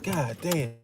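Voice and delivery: usual speaking voice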